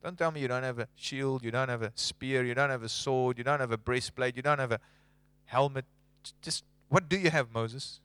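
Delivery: calm and low, gently firm; voice of God. Retold or imitated. God answering Moses, low and quiet. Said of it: "God said".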